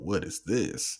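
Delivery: low, hushed mock diss delivery; imitating her talk. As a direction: drop low and soft, mimicking her. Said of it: "where she talks like this".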